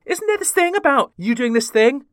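hint of a nosy host prompting a guest; mock Graham Norton. Mocking the host's stock opener to guests, lightly imitated. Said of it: "he'll kind of start a story with".

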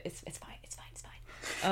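whispered to yourself; under-the-breath it's fine. Real, not acted. Brushing off own writing, whispered. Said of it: "self-deprecating".

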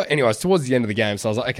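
ordinary talk, nothing marked

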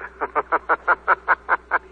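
mocking sinister laugh; eerie laugh. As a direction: laugh from the shadows. The Shadow's laugh.

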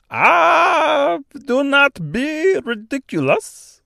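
put-on high exclaiming Austrian-French accent. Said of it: "strangely thick, almost Austrian accent".

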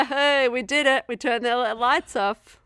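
hammed-up celebration; gleeful cowboy agents. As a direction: crow it like a pleased lawman. Acting gleeful FBI guys.

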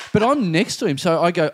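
usual speaking voice

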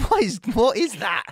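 mock shock. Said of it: "And I'm like".

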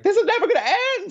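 laughing despair. Retold or imitated. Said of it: "I was just like".

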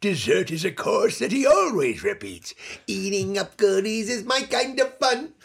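lightly voiced cartoon Smurf, sing-song; a hint of a cute little Smurf reciting; Sweetie Smurf voice. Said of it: "I was also Sweetie Smurf".

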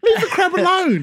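high laughing plea. Real, not acted. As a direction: laugh it out pleading.

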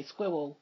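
"Squirrel" said "squewl", R turned to W. Retold or imitated. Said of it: "He would sort of say it as a W".

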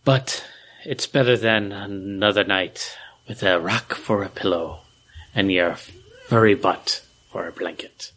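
low, dramatic character voice with pauses; hammed-up deep reading. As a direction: read it low and theatrical, pausing for weight. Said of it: "a dramatic reading".

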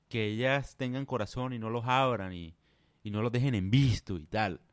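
ordinary talk

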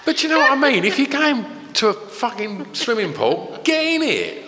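incredulous, voice climbing high; how can anyone not just do it; exasperated. Real, not acted. Incredulous rant about swimmers.

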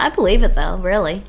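normal talking voice

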